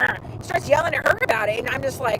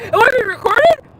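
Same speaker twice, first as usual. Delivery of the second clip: higher, louder whiny echo; mock panicky lawyer; 9 semitones higher, 6 dB louder, faster than usual. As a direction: squeal it back at him. Echoing lawyer's question mockingly.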